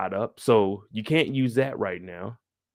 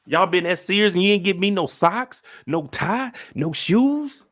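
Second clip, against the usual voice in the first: mock indignant father; 6 semitones higher than usual. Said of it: "Charles said".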